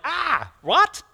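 high startled yelp; startled what. Retold or imitated. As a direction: jump up in pitch, caught off guard. Stressed disciples react to news.

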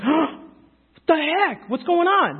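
high-pitched, stretched-out disbelief; shocked reaction. Acted. Preacher acting out sudden realization to congregation, shocked. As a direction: react as if you just saw something wrong. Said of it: "your first initial response to something when you see something's wrong".

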